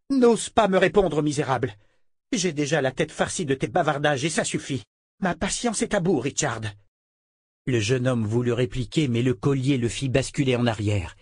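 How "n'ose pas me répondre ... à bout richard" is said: sœur exaspérée, voix aiguë; à bout de patience; colère de Verna. A narrator's reading. Verna gronde Richard. (Verna menace Richard, furieuse)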